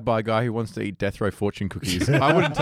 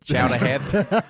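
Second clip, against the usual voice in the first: put-on slow, louder Boston-accent insult, laughing; friendly threat; 3 semitones higher, 5 dB louder, slower than usual. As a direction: razz them like a Boston tough. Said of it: "horrible Boston accents".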